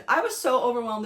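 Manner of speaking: hammed-up emotional gush; mock overwhelmed. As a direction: play up being overcome, half-joking. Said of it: "I'm getting emotional".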